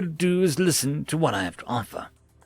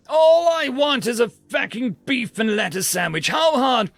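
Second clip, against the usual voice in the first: mild mock angry outburst; a customer losing patience at a machine, snapping; 8 semitones higher, 4 dB louder than usual. Customer snapping at the food dispenser, mildly angry.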